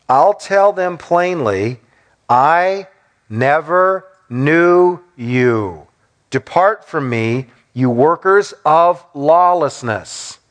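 slow, weighty pronouncement with pauses; solemn verdict. Acted. Jesus rejecting false followers, solemn and deliberate. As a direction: space out the words as a final judgment.

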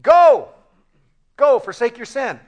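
a sudden loud, high call to go; loud command. Acted. Jesus ordering the struggling sinner away from sin, loud. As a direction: shout the order to leave sin.

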